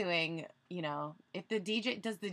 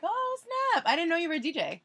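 surprised; 10 semitones higher, 5 dB louder, faster than usual. Retold or imitated. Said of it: "I'm like".

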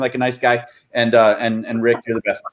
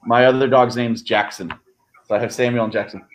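ordinary delivery

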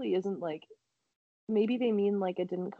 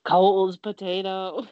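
mock dramatic; 6 dB louder, slower than usual. Echoing the review dramatically.

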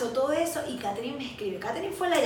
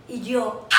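ordinary delivery

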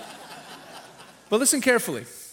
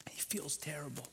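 whisper; 5 semitones lower, 18 dB quieter than usual. Acted. Rabbi dwells on Cain's remorse.

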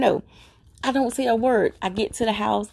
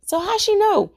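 high-pitched baffled challenge; imitated disbelief; 11 semitones higher than usual. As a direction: mimic a husband demanding an explanation. Husband challenging her in retold exchange.

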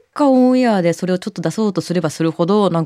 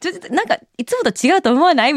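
retold teasing question; 11 semitones higher than usual. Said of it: "みたいなこと言った".

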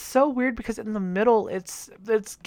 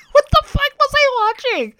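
shrill high yelp of shock at the show; shrieking disbelief; 6 dB louder than usual. Real, not acted. Vlogger shrieks at a baffling episode, disbelieving. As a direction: squeal it in disbelief.

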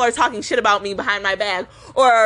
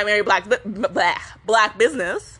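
real stuttering; 3 semitones lower than usual. Real, not acted. Telling y'all about lunch, accidentally stuttering. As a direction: stumble on the b, then restart the word. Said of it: "I'm over here stuttering".

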